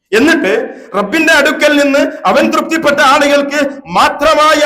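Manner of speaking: loud, high, sustained declamation; impassioned preaching. Real, not acted. Preacher denounces asking the dead Prophet for intercession, impassioned preaching. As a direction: preach at full force without letting up.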